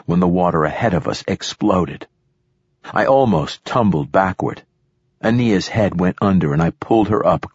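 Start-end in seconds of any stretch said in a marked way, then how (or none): none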